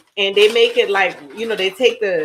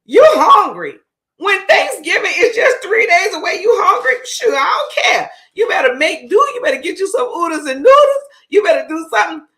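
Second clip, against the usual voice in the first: high, loud shouting mother; mock scolding mom; 10 semitones higher, 6 dB louder than usual. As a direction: bark at the hungry kid like mom. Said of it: "she is like".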